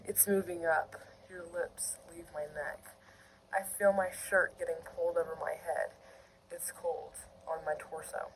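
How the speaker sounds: soft, low reading, fading to whisper; hushed story narration. A narrator's reading. Reader narrating an intimate scene, hushed narration. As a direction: read it quietly and intimately. Reads fiction aloud.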